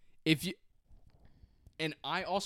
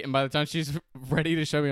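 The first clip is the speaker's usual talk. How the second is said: ordinary talk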